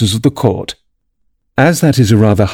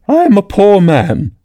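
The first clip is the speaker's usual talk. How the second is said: slow, high, pitiful plea; pleading Hatter; 10 semitones higher than usual. A narrator's reading. The Hatter pleads once more with the king, pitifully.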